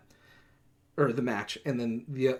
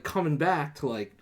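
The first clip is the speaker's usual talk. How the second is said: ordinary talk, nothing marked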